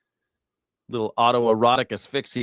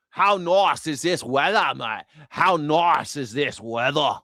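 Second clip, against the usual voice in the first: mock Australian; 6 semitones higher, slower than usual. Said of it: "imitating Australian speech".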